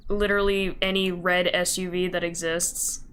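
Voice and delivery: normal talking voice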